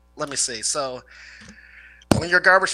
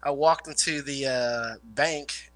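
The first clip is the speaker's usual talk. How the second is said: usual speaking voice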